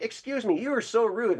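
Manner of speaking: mock prim high voice, indignant; hammed-up outraged lady; offended professor. Student retells the prim professor, mock indignant. Retold professor scolding him.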